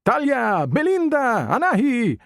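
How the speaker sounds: high, bright trilling DJ calling out names; trilling radio DJ. Acted. Radio DJ on the car stereo announcing pop singers, high trilling call. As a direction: sing out each name like a hyped radio host. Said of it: "a breathless D J trilling the names".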